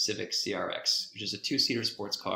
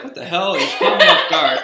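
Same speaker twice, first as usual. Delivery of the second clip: louder, higher, startled with a laugh; startled outburst; 5 semitones higher, 14 dB louder than usual. Retold or imitated. Driver startled by drumming passenger, loud startled laugh. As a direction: blurt it out caught off guard. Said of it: "So I'm sort of like".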